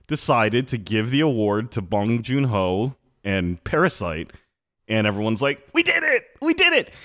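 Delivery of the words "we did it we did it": very high-pitched mock cheering; mock celebration. Podcaster mimicking everyone celebrating the Parasite win, mock high-pitched elation. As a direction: squeal it like an imitated overjoyed crowd.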